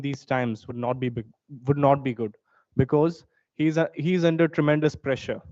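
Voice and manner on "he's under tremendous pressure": normal talking voice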